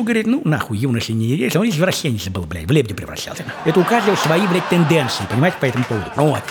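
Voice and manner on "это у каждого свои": normal talking voice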